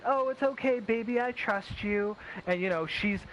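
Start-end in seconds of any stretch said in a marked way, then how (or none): 0.0-2.2 put-on higher, slow, overly trusting boyfriend; trusting pushover. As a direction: play the naive boyfriend who lets it slide. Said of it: "the guy that's like".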